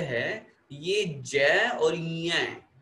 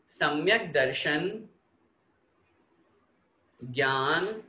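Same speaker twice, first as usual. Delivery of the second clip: slow, word by word chanting for students to repeat; slow recitation; slower than usual. Shown as a demonstration. Teacher leading pupils to repeat the sutra, slow and syllable by syllable. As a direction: pause after each word so the class can echo it. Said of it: "एक एक शब्द का उच्चारण करेंगे, आप लोग repeat करें".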